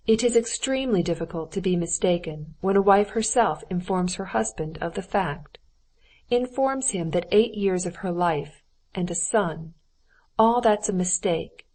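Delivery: usual speaking voice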